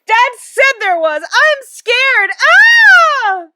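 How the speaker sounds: very high, loud scared wail; drawn-out squeal ending in a scream. A narrator's reading. Mother keeps on about the spider, scared squealing. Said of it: "but continued to squeal".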